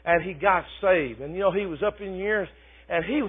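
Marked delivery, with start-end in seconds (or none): none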